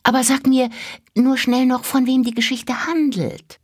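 put-on high, hurried child's plea; pleading little girl. A narrator's reading. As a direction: granddaughter begging grandfather, high and quick. Mira hushes and pleads.

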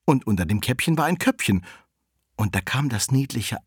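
plain reading voice

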